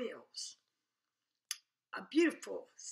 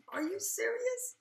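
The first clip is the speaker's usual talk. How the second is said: incredulous; 11 semitones higher than usual. Retold or imitated. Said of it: "I said".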